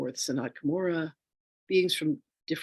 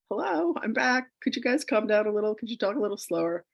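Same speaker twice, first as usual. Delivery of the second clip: tentative request; 6 semitones higher than usual. Retold or imitated. Acts out addressing the noisy beings.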